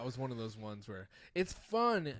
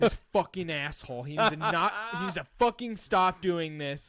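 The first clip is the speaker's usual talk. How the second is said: raised, loud mock outrage; imitated fuming; 6 semitones higher, 9 dB louder than usual. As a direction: rant like a fed-up friend, laughter creeping in. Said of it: "Devin starts fucking fuming".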